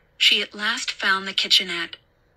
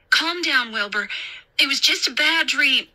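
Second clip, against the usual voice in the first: put-on higher voice over a child's panic; raised, insistent reassurance; urgently calming babysitter; 6 semitones higher than usual. A narrator's reading. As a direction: settle a frightened boy, firmly. Babysitter calms screaming boy.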